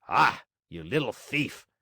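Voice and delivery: mildly angry exclamation; angry farmer. A narrator's reading. Farmer finds a boy in his trap, angry. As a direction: accuse him, with a touch of anger. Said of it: "said the farmer in an angry voice".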